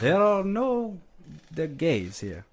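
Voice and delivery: lightly put-on Russian accent denial; mock Russian accent. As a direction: play a Russian official denying it. Mocking Russian official denial.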